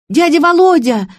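put-on high, loud little-girl cry; joyful childlike shout. A narrator's reading. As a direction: shriek the name like a child. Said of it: "закричала Травникова".

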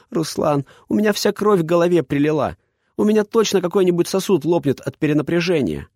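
put-on high, upset girl's voice; young woman complaining. A narrator's reading. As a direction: whine at him, blood rushing. Heroine voiced upside down.

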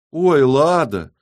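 loud, high, drawn-out feigned surprise; sarcastic oh-dear; mock surprise. A narrator's reading. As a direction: stretch it out in false dismay. Mock surprise at question about Lada.

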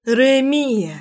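understated shout that falls away; mild narrated yell. A narrator's reading. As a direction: call out his name, holding back the full scream. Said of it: "заорала я на весь салон автомобиля".

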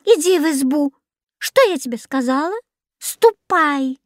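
high-pitched scolding order; stern. A narrator's reading. Old woman orders the cat indoors, stern. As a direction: send it off strictly. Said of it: "строго сказала старушка".

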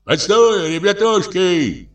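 put-on high, drawn-out old shepherd's call. A narrator's reading. Shepherd swimming out to the fishermen, calling out. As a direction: call to them to wait, pitched high. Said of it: "кричит он".

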